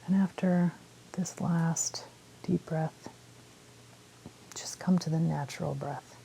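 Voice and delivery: calm meditation guide with long pauses; unhurried phrases, leaving space to breathe; guided meditation. Real, not acted. Guiding listeners through a body scan, calm meditation voice.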